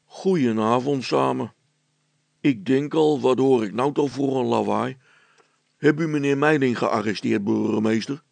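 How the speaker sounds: loud, official constable voice; a policeman barging in, booming; village constable. Acted. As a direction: constable loudly greeting and questioning the mayor. Village constable arrives and asks.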